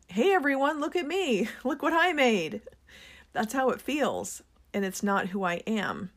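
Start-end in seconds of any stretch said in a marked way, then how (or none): 0.1-2.6 higher-pitched, attention-seeking call; mock yell. Introvert imagining having to promote her products, mock yell. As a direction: call out high, playing the show-off. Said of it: "with an introverted personality to yell".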